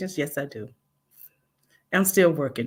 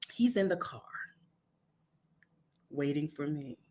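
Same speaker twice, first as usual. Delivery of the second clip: whispered; 4 semitones lower, 10 dB quieter than usual. Acted. Whispered aside about husband.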